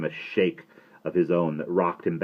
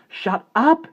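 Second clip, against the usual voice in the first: sharp urgent hiss, high and loud; 10 dB louder than usual. A narrator's reading. Boy hushing his giggling friend, sharp hiss. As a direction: snap it at him to hush him. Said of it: "he hissed".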